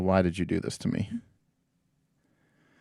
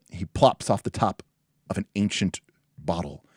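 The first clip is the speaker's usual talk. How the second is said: normal talking voice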